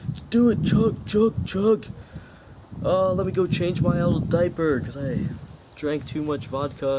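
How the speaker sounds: put-on high, partying old frat boy; frat bro. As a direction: whoop it up like an aging party bro. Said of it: "be a fifty-two-year-old and go to a college party and be like".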